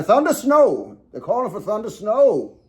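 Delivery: high, loud Southern character shout; battlefield cry. Acted. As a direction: holler it across a battlefield in mock alarm. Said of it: "You just hear him, like".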